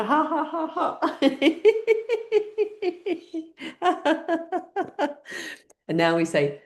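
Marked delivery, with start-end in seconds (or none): 0.0-1.2 high, rhythmic ha-has; mopping laugh. Shown as a demonstration. Cleaning the floor with mopping laughter, high laugh. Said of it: "Mopping laughter". 1.2-5.5 long high run of laughter; mopping laugh. Shown as a demonstration. Demonstrating the mopping laughter, long high laugh. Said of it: "Mopping laughter".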